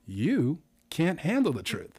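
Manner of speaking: slow, drawn-out delivery, stretching handle; slow spin. Shown as a demonstration. Host's second game example, slow and drawn out. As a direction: drag the words out slowly. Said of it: "another one just as an example".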